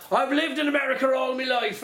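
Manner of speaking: high, hammed-up mock Irish accent; American actor badly faking an Irish accent. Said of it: "actors that can't do an accent".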